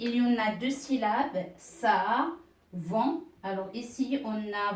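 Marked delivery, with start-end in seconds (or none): 1.6-3.2 "Savon" broken into two syllables with a gap; separated syllables. Shown as a demonstration. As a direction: say "Savon" as two detached beats. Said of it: "Je vais séparer". (« Savon » en séparant les deux syllabes)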